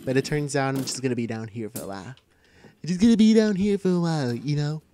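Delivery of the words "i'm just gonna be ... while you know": loud put-on cartoon character voice; Stewie Griffin. Gamer imitating a Family Guy character, put-on voice. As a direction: say it louder, in character. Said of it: "that was my impression of, uh, Stewie Griffin".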